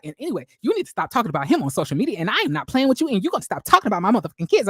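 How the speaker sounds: fast, heated rant that runs on; talking a mile a minute. Retold or imitated. Melody, worked up, rants fast at someone about her kids. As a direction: race through it while worked up. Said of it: "talking real fast".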